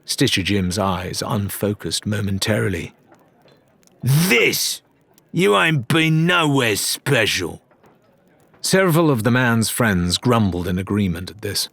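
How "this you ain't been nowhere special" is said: raised, higher rough sailor voice; pirate Jim accusing Ramsay, higher and hostile; angry pirate. Acted. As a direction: jeer at him like a drunk pirate.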